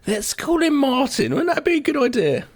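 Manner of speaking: sing-song parent, pleased with himself; mock naive parent. Mocking parents naming Martin.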